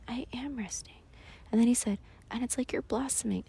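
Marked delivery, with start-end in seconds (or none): 0.1-1.0 whispered reply. Retold or imitated. 2.3-3.4 whispered quote. Retold or imitated.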